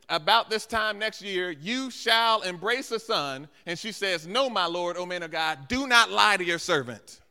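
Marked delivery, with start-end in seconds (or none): 0.1-3.5 loud, slow, higher-pitched proclamation; declaimed prophecy. Acted. Preacher declaims Elisha's prophecy. 4.3-7.2 loud, emphatic plea, slowing at the end; emotional protest. Acted. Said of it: "Do you hear the emotion".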